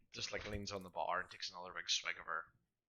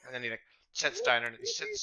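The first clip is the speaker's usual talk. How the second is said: ordinary delivery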